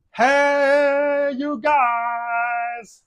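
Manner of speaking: high, loud playful yell, hey you guys; mock child yell. Host mimicking a child yelling just for fun, mock shout. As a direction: belt it out like a kid having fun.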